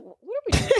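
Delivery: high-pitched baffled protest; mock bewilderment. Host acting out confusion at the plan, high mock bewilderment. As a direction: play it baffled, voice jumping way up.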